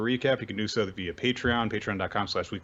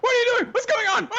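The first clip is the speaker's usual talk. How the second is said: much higher, loud panicked yelling; 8 dB louder, faster than usual. Retold or imitated. Captive yelling at his captors, high panicked shout. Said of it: "and he's like".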